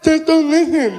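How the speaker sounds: high, loud thick-tongued punchline; partially deaf fan. Retold or imitated. Deaf fan gives his advice, high mimicked voice. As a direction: imitate blurred deaf speech, emphatic. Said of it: "he was partially deaf".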